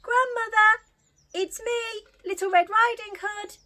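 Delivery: put-on high, loud little girl calling out. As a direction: call through the door as a girl. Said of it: "and called out".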